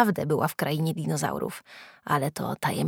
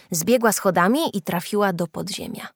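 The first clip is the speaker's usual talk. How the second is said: usual speaking voice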